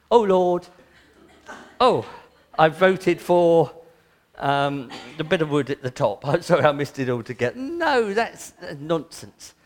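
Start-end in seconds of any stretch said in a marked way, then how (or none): 0.1-7.6 loud, hammed-up panicked prayer; mock foolish prayer. Preacher mocking a silly prayer at the ballot box, loud hammed-up plea. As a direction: ham up a scatterbrained believer praying over a ballot. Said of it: "we go there and go".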